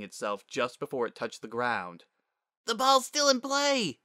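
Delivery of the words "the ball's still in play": put-on very high, loud child shout; squeaky urgent shout; young girl's yell. A narrator's reading. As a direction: yell it across the field as a kid. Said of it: "Spitfire yelled".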